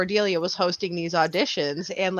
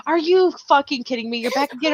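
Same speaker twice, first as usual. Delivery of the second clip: mock outrage; 7 semitones higher than usual. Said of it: "she's like".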